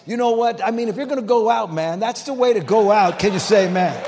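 loud, high-pitched climax; rousing preaching. Real, not acted. Preacher rallies the congregation for an amen, loud and fired up. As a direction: drive it home and call for an amen.

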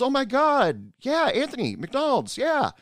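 high-pitched, delighted squeal of greeting; excited recognition. Retold or imitated. Old friend recognises a former coworker, excited greeting. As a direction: imitate a friend thrilled to recognise someone.

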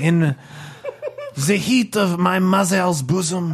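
wistful French bomb reminiscing; French accent. Acted. Bomb recalling its childhood, hammed-up French accent. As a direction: tell a life story in mock French. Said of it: "It's got eyes and a French accent".

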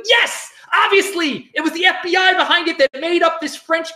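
high-pitched, over-excited conspiracy nut impression; hammed-up fervent believer; mock conspiracy believer. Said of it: "people who love conspiracies will be like".

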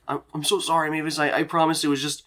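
embarrassed, awkward character voice; Dean. Acted. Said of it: "he looks so embarrassed, and he's like".

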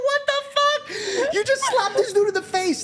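high-pitched laughing incredulity; retold shock, voice shooting way up; shocked disbelief. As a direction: squeal it in disbelief, breaking into laughter. Acted shocked reaction to dad's slap.

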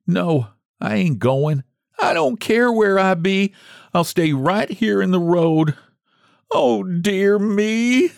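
high, stubborn lament; wailing. A narrator's reading. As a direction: wail and refuse to budge. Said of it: "clutching her box and wailing".

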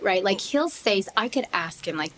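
normal talking voice

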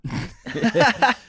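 high burst of laughter; sudden high giggling laugh. Real, not acted. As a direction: crack up mid ad read. Laughs mid sponsor read.

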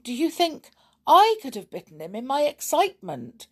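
high-pitched, innocent wondering; worried earnestness. A narrator's reading. Boy wondering aloud to the others, worried and serious. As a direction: ask it in all seriousness, worried. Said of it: "with a worried look".

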